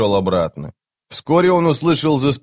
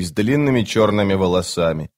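ordinary delivery

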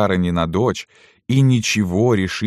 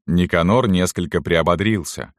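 ordinary delivery, nothing marked

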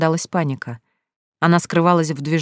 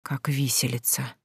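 very soft, slow and low; whispered; 4 semitones lower, 11 dB quieter than usual. A narrator's reading. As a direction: breathe it out in a slow hush. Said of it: "прошептала Наста". (медленным тихим шёпотом)

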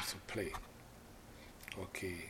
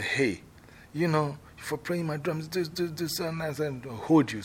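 scolding shout; 6 semitones higher than usual. Shown as a demonstration. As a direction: seize him and scold. Owner seizes the player.

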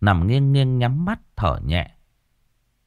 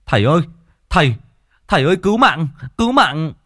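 loud, high cries for help; panicked calling; 7 semitones higher, 4 dB louder, faster than usual. A narrator's reading. Man running into the temple yard, panicked cries for the monk. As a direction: call out urgently in short bursts. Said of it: "hốt hoảng chạy vào trong sân".

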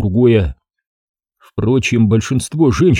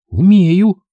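short, loud, much higher insistence; stubborn retort; 9 semitones higher, 4 dB louder than usual. A narrator's reading. Girl insists she can shoot, loud and defiant. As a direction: snap it back defiantly. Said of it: "упрямо дёрнула головой Ксения".

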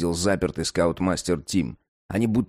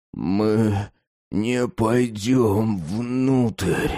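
halting, stretched-out words; stammering; 3 semitones higher, much slower than usual. Acted. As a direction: stumble over each word. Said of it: "запинаясь, произнёс он".

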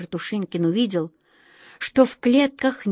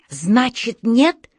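rising, louder ultimatum; menacing challenge; 3 semitones higher, 4 dB louder, much slower than usual. A narrator's reading. Wizard giving beasts one chance, menacing. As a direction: push the last word up as a threat.

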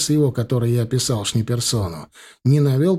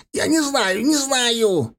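panicked pleading; 10 semitones higher, much slower than usual. A narrator's reading. Thug pleads, fingers crushed.

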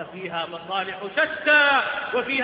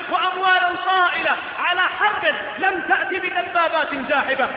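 impassioned, louder sermon cries; preaching at full force; 5 semitones higher, 4 dB louder than usual. Acted. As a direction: build each clause to a fiery peak. Sermon climax, repeated rising cries. (خطبة حماسية بصوت جهوري)

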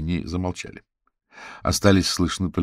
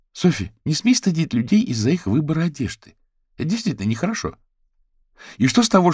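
scolding; 9 semitones higher, 6 dB louder, faster than usual. A narrator's reading. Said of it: "вела себя как рассердившийся родитель".

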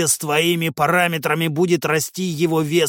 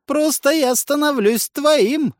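high, slow, gleeful strange voice; 12 semitones higher, 4 dB louder, slower than usual. A narrator's reading. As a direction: announce it with delight. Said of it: "ещё радостнее заявил голос".

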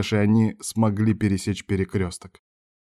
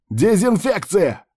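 high, drawn-out emergency order; stretched, raised alarm shout; shouted command; 11 semitones higher, 4 dB louder, slower than usual. A narrator's reading. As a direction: call the order out long and high.

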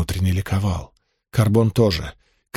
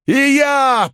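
much higher eager cry; ardent exclamation. Acted. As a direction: blurt it out with fire. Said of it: "пылко воскликнул Карбон".

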